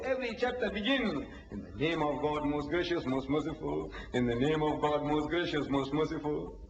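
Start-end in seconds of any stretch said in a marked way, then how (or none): 1.5-6.6 low, level intoning; sacred recitation. Shown as a demonstration. Speaker answering whose name the Prophet speaks in, solemnly intoned. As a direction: intone it like a repeated litany. Recites chapter opening formula.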